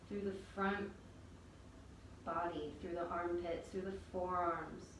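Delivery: usual speaking voice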